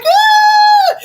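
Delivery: very loud high wail; mock crying wail. Smacked child crying out, mock wail. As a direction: burst into an imitated bawl. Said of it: "just crying".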